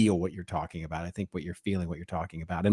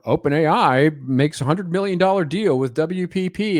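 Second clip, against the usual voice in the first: hyped-up headline voice, higher; mock headline; 8 semitones higher, 4 dB louder than usual. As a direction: read it like breathless clickbait. Mocking news headline.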